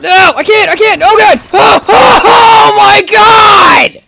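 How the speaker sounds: high, frantic shouting; panicked yelling. Real, not acted. Player who can't get away, panicked yelling. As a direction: wail it as you get cornered.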